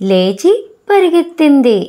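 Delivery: ordinary delivery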